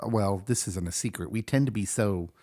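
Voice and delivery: ordinary delivery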